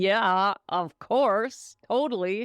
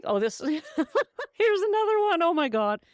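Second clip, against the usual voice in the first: laughing; 9 semitones higher than usual. Real, not acted. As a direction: crack up mid-sentence. Laughs at next question.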